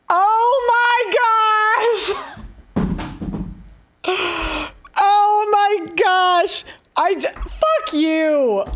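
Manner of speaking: real furious shout. Real, not acted. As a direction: explode in real disbelieving fury. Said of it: "oh, I'm so mad".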